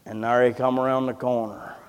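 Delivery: ordinary talk, nothing marked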